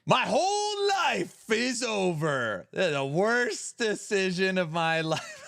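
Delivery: high, slow wail of hammed-up despair; milk the tragedy like a soap opera; slow, melodramatic lament, half laughing; mock despair. Mocking the comment dramatically.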